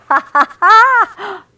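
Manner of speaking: slow, high, booming villain laugh; evil laugh. A narrator's reading. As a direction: cackle like a wicked wolf. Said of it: "Wolf laughed".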